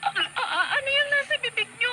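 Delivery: stammering, high and tearful; words catching in panic; frightened stammer. Acted. Daughter asking mother about the blood, high frightened stammer.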